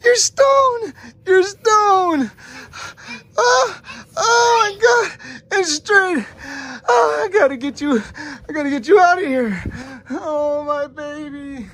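hammed-up sobbing; mock crying. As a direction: wail over your stone child, playfully. Dad pretends grief over stone child.

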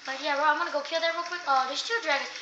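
ordinary delivery

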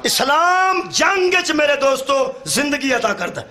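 high-pitched, ringing sermon delivery; impassioned preaching. Real, not acted. Preacher telling the crowd Islam never attacks first, impassioned.

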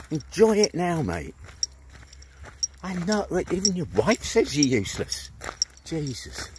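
normal talking voice

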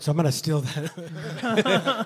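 slow down into a laugh. Real, not acted. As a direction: laugh breaking into the words. Joking about stealing the advice.

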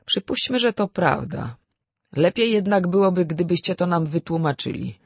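dry courtroom manner. A narrator's reading. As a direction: concede it coolly, then demand an explanation. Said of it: "Sędzia rzekł oschle".

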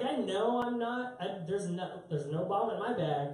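usual speaking voice

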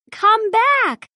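high call after a fleeing dog. A narrator's reading. Hot dog man calls after the runaway dog, high call. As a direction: call loudly, high and urgent. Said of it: "says the hot dog man".

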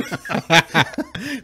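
high, loud burst of laughter. Real, not acted. Guest laughing after the balcony dog story, loud laugh. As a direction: laugh out the punchline.

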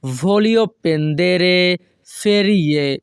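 very slow, careful Italian model reading; teacher pacing it out; slow model sentence. Shown as a demonstration. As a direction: draw each word out for learners. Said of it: "এর Italian ভাষা হবে".

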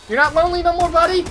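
loud, high teasing taunt; teasing sing-song. Pretend. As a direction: rub it in playfully. Teasing a teammate who opened door.

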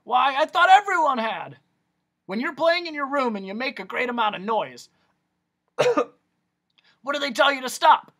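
loud high doctor; Doctor Discord. Acted. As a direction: quiz the boy like a showman. Doctor quizzing Milo.

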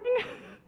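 short, soft real laugh; embarrassed laugh. Real, not acted. Children's leader laughing after greeting the kids, embarrassed laugh. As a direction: laugh at your own failing voice.

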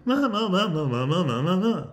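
loud, swooping ma-ma-ma; exaggerated voice-loosening noises; vocal warm-up babble. Shown as a demonstration. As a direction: shake out the voice with silly syllables. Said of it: "why is my voice like that".

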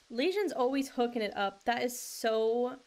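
ordinary talk, nothing marked

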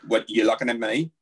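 menacing Northern Irish growl of words; Northern Irish accent. Shown as a demonstration. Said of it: "Northern Irish often sounds like you wanna kill somebody".

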